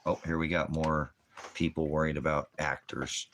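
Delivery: ordinary talk, nothing marked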